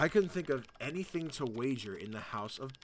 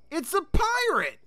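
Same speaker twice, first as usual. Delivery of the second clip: much higher, slow cry of surprise; high startled exclamation; surprised shout; 7 dB louder, slower than usual. Real, not acted. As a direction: gasp it out in shock. Discovers pirate through keyhole.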